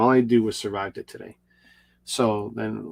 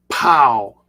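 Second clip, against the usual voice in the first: loud sudden pow; 3 semitones higher, 8 dB louder than usual. Retold or imitated. Sudden tooth pain, mock blast.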